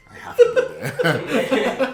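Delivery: high, loud and slow, overacted duty; mock dramatic. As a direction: wail it out with mock heroism.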